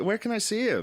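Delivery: high-pitched mock fan voice; impressed passenger. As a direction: ask eagerly in a put-on high voice. Imagined passenger answers the bragging driver.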